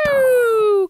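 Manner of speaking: high, loud boom imitation; bomb explosion noise. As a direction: shout the bomb going off. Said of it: "you hear".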